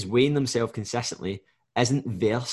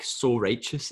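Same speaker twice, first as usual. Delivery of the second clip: ordinary talk, nothing marked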